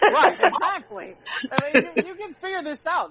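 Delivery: high, loud and exasperated; exasperated outburst. Real, not acted. Friend ranting about small-town Amazon, exasperated and loud. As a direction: throw your hands up at the obvious.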